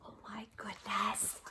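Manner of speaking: breathless amazement; awed whisper. Real, not acted. Reader amazed by the home run, whispering. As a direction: gasp it out softly.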